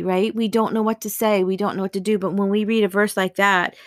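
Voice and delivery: ordinary talk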